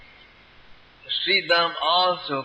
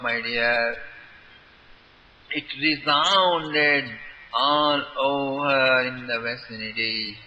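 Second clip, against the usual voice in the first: ordinary delivery, nothing marked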